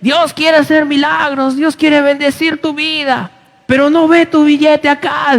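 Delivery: high-pitched imitation of a fervent money preacher; mock preacher's shout. Pastor showing congregation how the minister begged, mock shouting. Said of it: "Y decía así".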